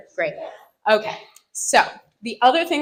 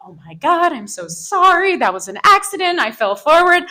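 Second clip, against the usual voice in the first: high-pitched, gushing apology; pleading, over-apologetic and high; acted apology; 7 semitones higher, 3 dB louder than usual. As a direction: blurt out a mock-mortified apology. Imagined apology to bitten woman.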